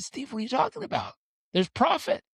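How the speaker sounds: high, incredulous protest; retold disbelief. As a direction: object in a high, baffled pitch.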